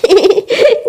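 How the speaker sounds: short, light giggle. Acted. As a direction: giggle briefly before answering. Daughter-in-law giggles at mother-in-law's scolding.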